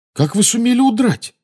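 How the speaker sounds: high, loud astonishment; amazed question. A narrator's reading. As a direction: ask in amazement, voice leaping up. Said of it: "изумлённо спросил Гарри". (изумлённый вопрос, высоко и громко)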